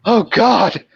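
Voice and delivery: mock-panicked moans, pitched high; imitated panic. Patient panics when asked her address, imitated panic.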